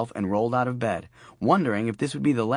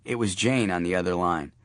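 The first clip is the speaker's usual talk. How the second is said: ordinary delivery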